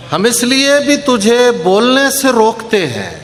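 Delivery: slow, measured mushaira recitation; poetry recitation. Acted. Poet reciting ghazal to a mushaira audience, measured declamation. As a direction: recite the couplets, drawing out key words.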